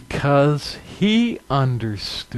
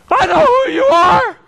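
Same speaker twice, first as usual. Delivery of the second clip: high, loud terrified shriek; shrieking in terror; 5 dB louder than usual. Acted. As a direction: scream it at him, cornered and afraid. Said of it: "he cried out".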